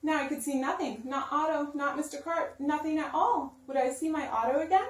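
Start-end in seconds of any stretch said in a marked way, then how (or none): none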